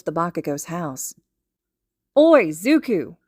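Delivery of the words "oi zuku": put-on loud, slow boyish call through a window; muffled shout. A narrator's reading. Boy shouts to his friend from the window. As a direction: holler it from behind glass. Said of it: "Kacchan's shout is muffled".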